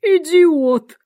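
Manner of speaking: high, loud snap of an insult; angry. A narrator's reading. Queen to a subject, angry and indignant. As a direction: hurl it out high and loud, angrily. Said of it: "гневно тряся головой".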